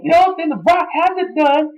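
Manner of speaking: high mock taunt; The Rock impression. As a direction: sneer it in a squeaky voice. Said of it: "he was saying".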